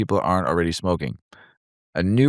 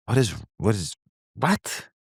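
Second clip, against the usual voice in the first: what is, what is, WHAT?, last word jumping high; baffled double-take rising to a squeak; incredulous; 5 semitones higher than usual. Real, not acted. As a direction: caught off guard by a ban. Baffled reaction to news.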